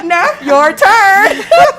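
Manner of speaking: slow, laughing shout; laughing, drawn-out handoff to Neff; laughing callout. Real, not acted. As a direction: call the next one up while laughing. Handing over, laughing.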